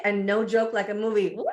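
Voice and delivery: ordinary talk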